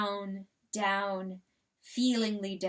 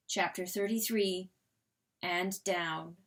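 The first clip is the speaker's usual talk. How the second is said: plain reading voice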